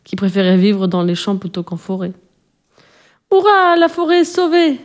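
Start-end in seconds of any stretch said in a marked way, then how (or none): 3.3-4.8 high, joyful little mouse cheer; joyful cry. Acted. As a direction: squeal with relief that the forest is saved. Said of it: "s'écria une sourisette".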